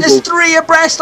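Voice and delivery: excited. Real, not acted. Sudden three-wide battle.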